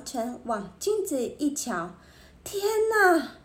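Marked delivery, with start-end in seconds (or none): 2.4-3.3 high, aghast exclamation; shocked. A narrator's reading. Barber sees his ruined hair in the mirror, aghast. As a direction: gasp out the words in horror. Shock at seeing the botched haircut.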